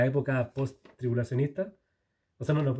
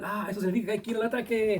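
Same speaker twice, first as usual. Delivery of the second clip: soldiers in a war film; 7 semitones higher, faster than usual. Retold or imitated. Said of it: "películas de guerra".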